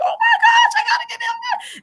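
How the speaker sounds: retold frantic wail, very high-pitched; crying, going crazy. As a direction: squeal it as a hammed-up, tearful freak-out. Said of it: "So I'm crying. I'm going crazy".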